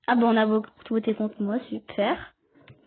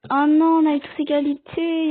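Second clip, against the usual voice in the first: much louder, higher oh non; real dismay; 5 semitones higher, 6 dB louder than usual. Real, not acted. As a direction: react loudly to the tie. Vote ends in a tie.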